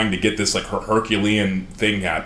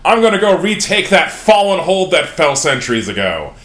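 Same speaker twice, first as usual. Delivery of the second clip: loud, higher boastful declaration; drunken proclamation; 7 semitones higher, 5 dB louder than usual. Retold or imitated. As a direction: proclaim it like a drunk old king. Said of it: "drunkenly proclaiming".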